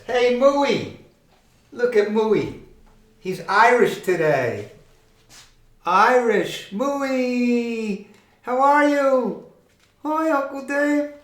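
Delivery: high, bright call to the puppet; light up at seeing Mooey; excited greeting. Acted.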